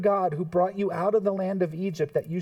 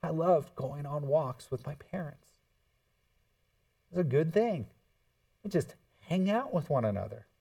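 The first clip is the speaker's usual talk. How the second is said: ordinary delivery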